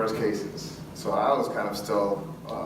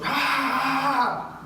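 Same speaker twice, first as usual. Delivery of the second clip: sharp high scream; imitated scream. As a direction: let out a sudden shrill yell of terror. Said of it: "he started screaming at the top of his lungs".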